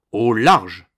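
loud, forceful command; guard's shouted order. A narrator's reading. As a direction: repel him with a shouted order. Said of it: "répondit le garde". (ordre crié du garde)

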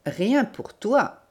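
high, slow grumble; drawn-out, mildly grumbling put-down; grumbled retort. A narrator's reading. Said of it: "grogna monsieur Lenormand".

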